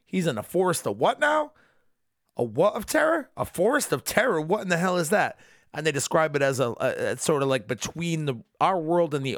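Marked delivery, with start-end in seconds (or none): 0.1-5.4 high, incredulous questioning; hammed-up bafflement, pitch leaping up; mock disbelief. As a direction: play it utterly baffled. Wife's disbelieving reaction acted out.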